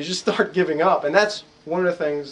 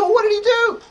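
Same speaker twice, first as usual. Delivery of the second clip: incredulous question; 5 dB louder than usual. Retold or imitated.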